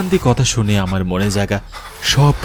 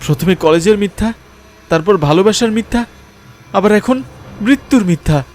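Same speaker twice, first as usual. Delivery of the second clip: high-pitched, heated accusing outburst; agitated accusation; 10 semitones higher than usual. Acted. Man accusing the ghost girl of endless lies, agitated. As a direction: protest in a raised, much higher voice.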